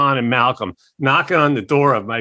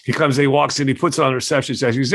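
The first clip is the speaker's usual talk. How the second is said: ordinary talk, nothing marked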